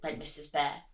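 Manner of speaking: usual speaking voice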